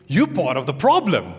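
high, loud accusing complaint; frustrated accusation. Acted. Martha accuses Jesus of being part of the problem, frustrated. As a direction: blame the Lord, exasperated. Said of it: "the frustration is also directed at the Lord".

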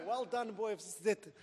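loud, high cheer of a proud dad; screaming. Retold or imitated. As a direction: shout praise as if from the crowd. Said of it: "heard this man screaming".

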